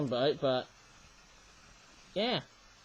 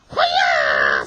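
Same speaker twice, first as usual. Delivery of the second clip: low, loud hoo-yah shout; ninja sound effect; 11 dB louder than usual. Pretend. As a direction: bark it like a ninja. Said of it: "sound effect whenever I see ninja penguins".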